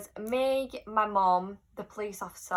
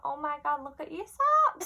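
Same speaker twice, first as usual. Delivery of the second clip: very high, sing-out star impression; 7 semitones higher than usual. Singer spotting a fan's socks, high gushing imitation. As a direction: squeal it like the singer, pitched far up. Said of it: "imagine it in her voice".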